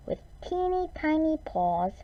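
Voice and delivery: high, small storyteller voice; teeny tiny voice. A narrator's reading. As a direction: shrink it up high for the baby bear. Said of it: "a teeny tiny little voice".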